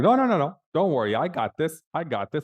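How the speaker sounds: high-pitched protest settling down; insistent, high don't-worry; hasty reassurance. Retold or imitated. Waving off brother's panic.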